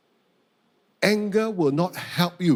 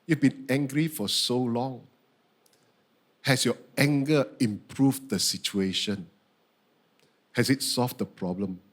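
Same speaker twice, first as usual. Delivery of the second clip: low, gentle, measured questioning; calm deep divine voice; God's questioning voice; 6 semitones lower than usual. Retold or imitated. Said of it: "Then God asked me the next question".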